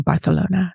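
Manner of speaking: "Barcelona" with th for the c; Castilian lisp. Retold or imitated. Telling of two German-class classmates, "Barcelona" with their lisped c. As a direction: say "Barcelona" as Bar-the-lona.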